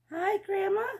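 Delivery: high, loud bright greeting; girl calling hello into the cottage; little girl voice. Acted. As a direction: call out like a cheerful little girl.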